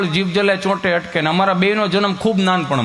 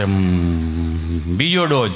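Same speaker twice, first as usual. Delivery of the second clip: low hesitant stutter, drawn out; a nervous stammerer forcing out his answer; stammering friend; 12 semitones lower, much slower than usual. Acted. Stammering patient answers the nurse, low and hesitant. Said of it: "જીભ જલે, ચોંટે અટકે ને".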